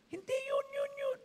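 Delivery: very high-pitched imitation of someone pointing; high, comic retold correction; insistent correction. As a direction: mimic an eager, squeaky insistence. Acted insisting while pointing someone out.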